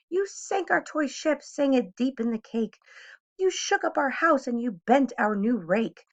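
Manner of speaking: a fed-up fish telling the cat off; furious little fish yelling; angry fish. Acted.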